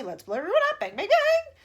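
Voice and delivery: loud, high, gleeful bangs; gleeful bombing. Acted. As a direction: relish the destruction aloud. Army chief gleeful about bombing.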